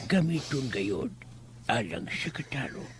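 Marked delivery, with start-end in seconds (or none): 1.7-2.9 whisper. Acted. Elder ending the saying.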